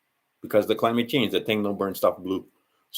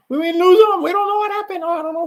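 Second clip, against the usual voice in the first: much higher, louder, mocking whiny officials; mocking excuse voice; 10 dB louder, faster than usual. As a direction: play the clueless official shrugging it off.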